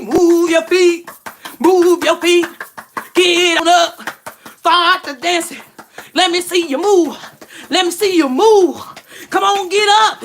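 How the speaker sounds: high, loud preacher shouting the church up; preacher calling out. Retold or imitated. Pastor urging the church to get up, hammed-up loud preaching. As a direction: rouse the congregation to dance. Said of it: "they be like".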